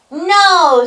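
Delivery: high, loud refusal; booming storybook goat; defiant shout. A narrator's reading. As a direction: shout it right back at the troll. Big goat defies the troll.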